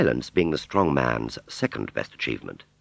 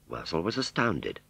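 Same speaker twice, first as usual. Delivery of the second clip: ordinary delivery, nothing marked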